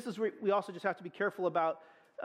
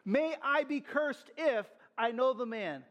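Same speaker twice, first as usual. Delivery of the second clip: solemn oath; 5 semitones higher, slower than usual. Acted. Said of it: "He's announcing a curse".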